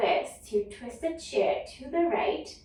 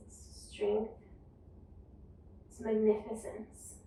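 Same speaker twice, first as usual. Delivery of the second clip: guided yoga cueing. Acted. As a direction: guide the class calmly through each breath. Leads a guided yoga flow.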